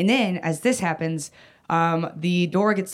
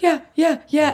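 high, louder retold nodding agreement; quick-to-please yeses, pitched way up; placating yeses; 10 semitones higher, 5 dB louder than usual. Child humoring a mother pinning her down, placating.

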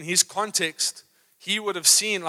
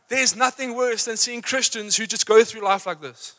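ordinary talk

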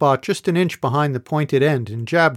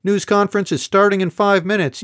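higher, louder call across the room; 6 semitones higher, 4 dB louder than usual. A narrator's reading. Ebeth calling from a bar stool to everyone, raised voice. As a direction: call it over to the group. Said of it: "Ebeth called from her perch".

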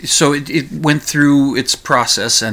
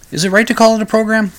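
self-mocking aside; 11 semitones higher, much faster than usual. Real, not acted. Host mocks his own word.

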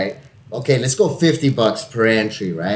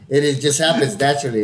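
usual speaking voice

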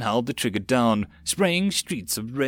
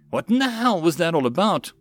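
raised-pitch challenging question; indignant demand; 6 semitones higher, 3 dB louder than usual. Acted. Terrier confronting Mills after the attack, indignant. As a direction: demand an explanation.

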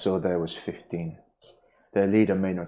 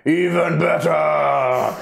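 dopey cartoon bellow; 6 semitones higher, 5 dB louder, much slower than usual. Booming voice answers eagerly.

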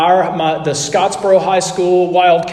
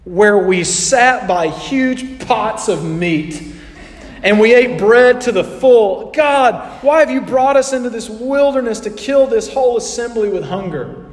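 whining, higher melodramatic lament; dramatic complaining; 4 semitones higher than usual. Retold or imitated. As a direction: overplay the Israelites' self-pity. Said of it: "we go overboard".

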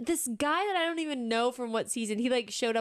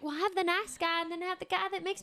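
put-on Southern accent; 9 semitones higher than usual. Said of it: "you do a good Southern accent".

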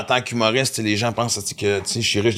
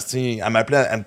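usual speaking voice

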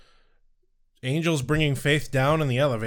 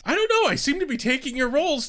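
high squeaky voice; 12 semitones higher, much faster than usual. Pretend. High silly reply.

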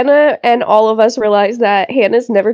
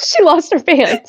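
laughing; 6 semitones higher than usual. Real, not acted. Laughing while recounting scene.

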